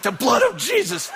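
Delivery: high-pitched desperate shout, acted; frantic comic battle cry; panicked cry. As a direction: shout it high mid-struggle. Cries out while choking each other.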